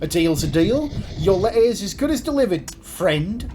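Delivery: postman Creed, delighted. Acted. Creed thrilled by his new friend's pose, high and gushing. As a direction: gush like a starstruck Kiwi-sounding postman.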